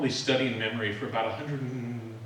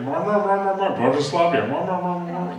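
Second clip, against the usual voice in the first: loud Lithuanian-sounding babble, like a radio newsreader; mock Lithuanian gibberish; 4 semitones higher, 7 dB louder than usual. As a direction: imitate Lithuanian as pure sound. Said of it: "mar, mar is my imitation of Lithuanian".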